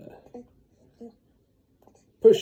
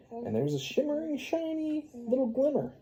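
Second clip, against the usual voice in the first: awed storytelling; 13 semitones higher than usual. A narrator's reading. Storytelling awe, high voice.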